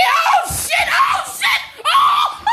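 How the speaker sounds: very high shrieks of fright; real panicked scream. Real, not acted. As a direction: shriek in sudden terror. Bird comes at her.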